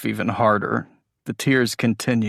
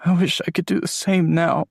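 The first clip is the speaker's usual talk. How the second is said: voice breaking; 8 semitones higher, 4 dB louder than usual. Acted. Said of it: "His voice breaks".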